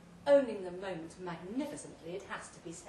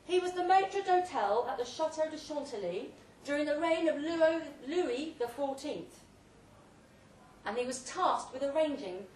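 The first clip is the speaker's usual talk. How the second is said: louder and higher, orating to the guests; booming tale told through a loud hailer; grand loud speech; 5 semitones higher, 6 dB louder than usual. Acted. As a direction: hold forth like a host at a banquet.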